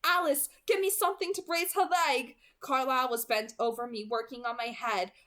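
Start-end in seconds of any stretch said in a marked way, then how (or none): 0.0-2.4 raised, higher, louder order; urgent call across the room to Alice, loud; urgent command. Acted. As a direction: bark it out in a crisis. Urgent order during emergency.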